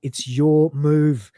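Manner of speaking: very slow deadpan It's your move; slow flat Lister. Retold or imitated. Lister prodding Cat to play, slowly. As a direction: drag it out, bored. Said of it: "Lister says".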